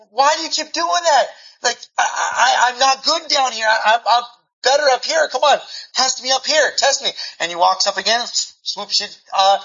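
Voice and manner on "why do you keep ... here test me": higher, pleading student voice; a hint of a frustrated student begging the pastor; whiny protest. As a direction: beg him to test you up high. Student whining at repeated sweeps.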